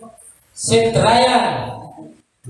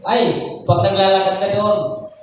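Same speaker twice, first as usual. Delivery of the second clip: ordinary talk, nothing marked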